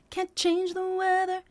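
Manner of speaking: sung slowly with a bounce on "change". Shown as a demonstration. Coach demonstrating the bounce on change. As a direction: lift the word "change". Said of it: "a little bit of a bounce on the word change".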